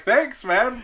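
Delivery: high, laughing thanks; fake-polite thanks; mock gratitude. Thanking the singer for an empty can, mock-polite. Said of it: "I was like".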